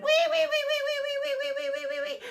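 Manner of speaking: very high squealing wee wee; pigs squealing wee. Acted. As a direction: squeal like frightened piglets. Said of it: "they went".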